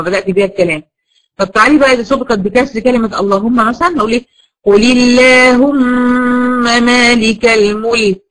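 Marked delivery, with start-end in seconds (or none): 4.6-8.2 slow, melodic tajweed chant; Quran recitation. Acted. Teacher reciting her favourite verse, melodic recitation. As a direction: draw out each word in measured recitation.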